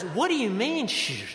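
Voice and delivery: fiancé refusing to be hushed; indignant retort. Retold or imitated. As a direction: push back at being shushed. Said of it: "and he just says".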